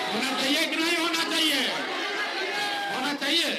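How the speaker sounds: loud, high rally call to crowd; booming political rally speech. Real, not acted. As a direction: rouse the crowd for an answer.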